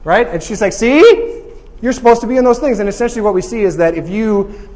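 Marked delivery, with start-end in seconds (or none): 0.7-2.8 Lily excited. Retold or imitated. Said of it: "Lily gets excited".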